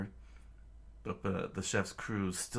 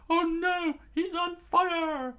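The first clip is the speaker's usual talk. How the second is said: high, frantic group-panic voice; shrill-pitched alarm easing into high explanation; crew screaming; 12 semitones higher, 3 dB louder than usual. A narrator's reading. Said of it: "the crew screamed out".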